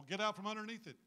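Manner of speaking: ordinary delivery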